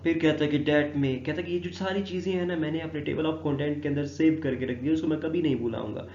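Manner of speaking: ordinary talk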